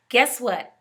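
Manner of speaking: usual speaking voice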